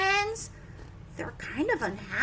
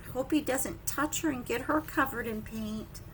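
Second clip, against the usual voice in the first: plain reading voice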